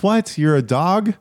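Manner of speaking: high-pitched incredulous question; mock-astonished, jumping up high; amused disbelief. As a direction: imitate a stranger baffled by the name. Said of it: "he just laughed. He said".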